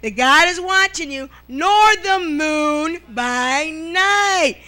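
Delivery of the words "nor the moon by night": very slow, drawn-out preaching; preached scripture. Acted. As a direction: stretch every word like a pulpit reading.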